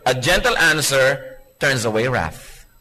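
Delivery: slow, gentle scripture quote; gentle reading. Shown as a demonstration. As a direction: read it slowly and gently. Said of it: "Sabi sa Bible".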